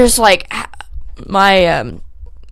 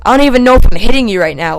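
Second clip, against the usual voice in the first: fast, loud re-enacted rant; rushed mock yelling; retold heated outburst; 3 semitones higher, 7 dB louder, much faster than usual. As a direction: blurt it out quickly in a raised voice. Retold yelling while hitting him.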